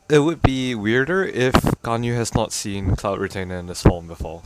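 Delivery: normal talking voice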